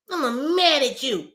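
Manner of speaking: put-on high, slow, sulky little child voice; pouty toddler. Toddler to mommy, high slow sulky child voice. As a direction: play a sulking toddler telling mommy off. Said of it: "toddler wanna be mad at mommy".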